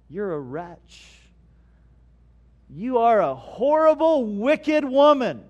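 hammed-up scolding, slow and drawn out; mock-stern denunciation, stretching the insults; mock condemnation. Mock-condemning misquote of scripture.

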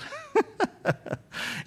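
breathy chuckle to himself. Real, not acted. Preacher reflecting on forty years of friendship, chuckling. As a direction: laugh lightly, without words.

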